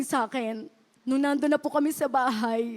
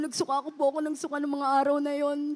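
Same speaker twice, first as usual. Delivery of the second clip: ordinary talk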